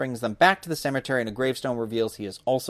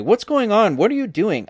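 frightened; 8 semitones higher, 3 dB louder than usual. A narrator's reading. Frightened boy yells at friend.

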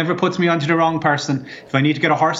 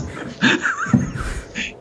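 breathless high laugh; 3 dB quieter than usual. Acted. Laughing at his own pub habit.

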